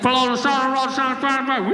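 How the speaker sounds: muffled, barely intelligible cop orders; irritated police loudspeaker. Retold or imitated. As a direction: run the words together like a cop on a bad speaker. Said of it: "he gets irritated".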